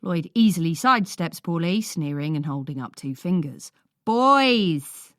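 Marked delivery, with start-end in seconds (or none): 4.0-5.1 loud higher call to order; shout. A narrator's reading. Carer breaking up fighting brothers, loud shout. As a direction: bark it to stop the fight. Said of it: "I shout".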